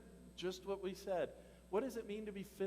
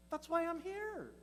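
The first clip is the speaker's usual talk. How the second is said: high echo; 9 semitones higher, slower than usual. Acted. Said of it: "repeats Jesus's line for himself".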